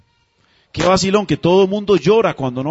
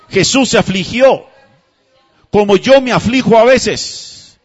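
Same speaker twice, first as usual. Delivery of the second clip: building louder and slower each line; fervent preaching; 4 semitones higher, 5 dB louder than usual. Acted. Preacher building to a climax before the congregation, fervent. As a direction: swell it up, line by line, to a slow climax.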